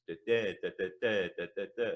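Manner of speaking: da da in an even bouncing rhythm; regular Italian beat. Shown as a demonstration. Teacher copying Italian rhythm, da da in regular stresses. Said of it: "there's a regular rhythm in that".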